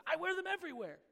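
high-pitched, imitated child's protest; protesting little girl. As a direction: insist like a little daughter clinging to treasure. Retold daughter clings to her pearls.

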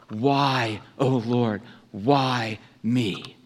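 ordinary talk, nothing marked

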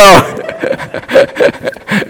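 a quiet unvoiced chuckle; soft airy chuckle; soft breathy laugh. Real, not acted. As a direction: laugh under your breath at the joke. Laughs at own joke.

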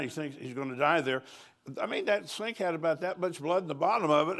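ordinary talk

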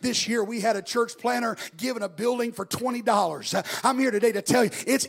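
rising to a booming shout; preaching at full force. Acted. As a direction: preach it out loud, building hard. Preacher builds again.

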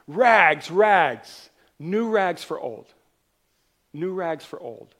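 loud street call, drawn out like a peddler; crying out wares. Acted. Rag man calling through the city streets, loud peddler cry. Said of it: "he's crying out".

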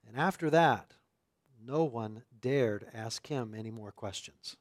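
measured public Bible reading; scripture reading. Acted. Pastor reading Scripture to the congregation, measured and clear. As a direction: read the passage out to the congregation. Reads the Gospel passage aloud.

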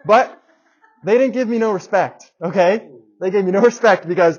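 high, loud and fed up; heated outburst. Real, not acted. Ranting to the congregation about the company, loud and fed up. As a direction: blow up about being ignored.

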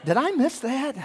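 high, baffled church member; puzzled voice. Retold or imitated. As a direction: ask like you missed something. Congregants' puzzled question.

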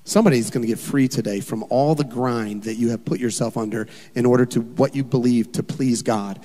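ordinary talk

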